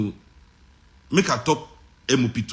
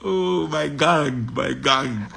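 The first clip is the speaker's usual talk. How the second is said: amused drawl; much slower than usual. Real, not acted. Repeating wife's tease, amused.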